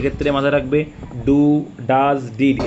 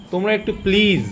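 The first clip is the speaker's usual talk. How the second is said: pleading; 5 semitones higher than usual. Real, not acted. Teacher begging students to take notes, pleading. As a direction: beg them, stretching the please. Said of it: "হাত জোর করে বলছি".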